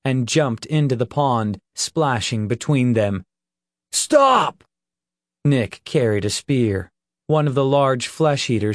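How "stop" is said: loud high shout. A narrator's reading. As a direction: yell to halt the fight. Nick shouts between them.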